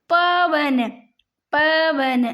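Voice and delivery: "पवन" slowly drawn out, pa-van; sounded out. Shown as a demonstration. Teacher showing the av sound, "पवन" drawn out. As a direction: stretch both syllables of "पवन". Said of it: "अव आ रहा है".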